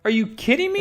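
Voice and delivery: high, outraged disbelief; livid. Retold or imitated. Johnny catching Kitty taking his nephew, livid and high-pitched. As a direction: sputter it in outrage. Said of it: "and he is livid".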